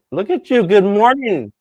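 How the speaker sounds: high, loud bright hello; light up as she joins; excited greeting. Real, not acted. Greeting Samantha as she joins the stream, excited and high.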